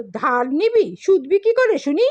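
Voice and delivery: mock him with a sharp, high jeer; sneering priest. A narrator's reading. Priest sneers at poor Gafur.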